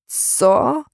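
mildly outraged disbelief; indignant. A narrator's reading. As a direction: react as if insulted, pitch jumping up. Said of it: "oburzył się".

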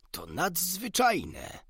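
high, slow, drawn-out amazement; amazed exclamation. A narrator's reading. Doctor marvelling at the canary's singing, amazed exclamation. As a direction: stretch it out high in wonder. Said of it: "zawołał doktor".

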